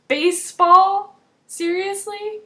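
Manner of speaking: can't believe the show is off for this; outraged fan in disbelief; indignant disbelief. Real, not acted. Show delayed for baseball.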